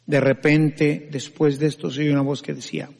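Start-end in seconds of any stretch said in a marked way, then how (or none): none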